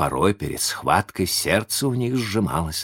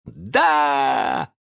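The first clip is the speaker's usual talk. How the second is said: крик; 6 semitones higher than usual. A narrator's reading. Boys reply all around.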